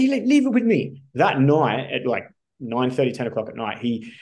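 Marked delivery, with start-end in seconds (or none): 0.0-1.0 high, loud Irish impersonation; Irish mentor. Retold or imitated. Mentor agreeing to arrange it, imitated Irish accent. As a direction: mimic an Irish friend with voice pitched way up. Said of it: "he's Irish".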